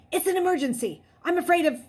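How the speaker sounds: high, loud frightened plea; panicked and pleading; desperate. A narrator's reading. Desperate boy on phone.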